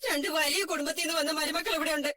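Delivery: usual speaking voice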